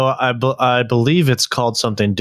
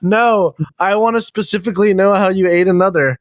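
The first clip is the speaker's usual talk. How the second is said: higher-pitched exasperated insistence; 6 semitones higher than usual. Acted. Ranting about useless rules searches, exasperated. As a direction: insist in mock frustration, pitched up. Acted frustrated reply to searchers.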